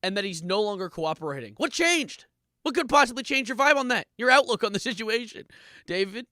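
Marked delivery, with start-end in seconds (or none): 1.6-6.2 higher, louder disbelief; incredulous questions. Real, not acted. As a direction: demand answers in disbelief. Incredulous questioning about David.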